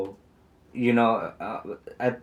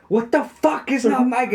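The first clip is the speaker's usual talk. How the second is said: much higher-pitched mock outrage; pissed-off retelling; 12 semitones higher, 4 dB louder than usual. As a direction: imitate someone pissed off, voice pitched way up. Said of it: "And all pissed and shit".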